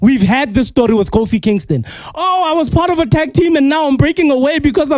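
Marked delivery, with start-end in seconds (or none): 2.2-5.0 high, fast whiny wrestler voice; mocking wrestler voice. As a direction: parody a self-pitying champion.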